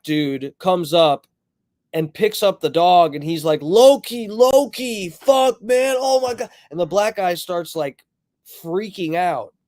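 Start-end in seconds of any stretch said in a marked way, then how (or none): 3.6-6.5 high, loud frantic calling of the dog's name; retold panicked outburst, pitched high; panicked cry. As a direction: imitate a man panicking over his dog. Owner finds his dead dog.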